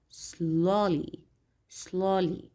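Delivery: "slowly" with a long, stressed O in the first syllable. Shown as a demonstration. Teacher modelling adverb stress for students, first-syllable stress on "slowly". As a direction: stretch the SLOW in "slowly", let -ly fall. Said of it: "So look at the first syllable. We have the stress".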